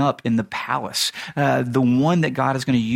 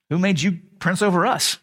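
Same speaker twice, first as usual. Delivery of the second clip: higher-pitched mock indignation; indignant challenge; 6 semitones higher than usual. Two fighting Israelites turn on Moses, indignant challenge. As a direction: act out an affronted challenge.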